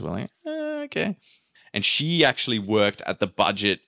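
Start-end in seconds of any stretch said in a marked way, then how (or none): 0.4-1.2 high-pitched mock surprise. Mock reaction to return fee.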